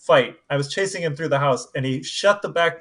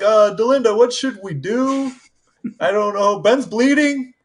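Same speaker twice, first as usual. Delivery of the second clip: high, pleading panic; panicked kids; 7 semitones higher, 4 dB louder than usual. Retold or imitated. Kids phoning Delinda about bleeding Ben, high panic. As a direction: plead like scared children on the phone. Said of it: "We're like".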